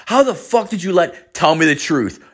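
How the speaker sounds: high-pitched, indignant demand; super mad instructor. Retold or imitated. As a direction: imitate an angry teacher demanding answers. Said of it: "then he gets super mad".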